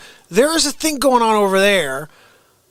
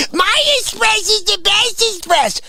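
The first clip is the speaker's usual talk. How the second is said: mock boast; 11 semitones higher than usual. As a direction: shout the brag like a pompous fool. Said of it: "You go".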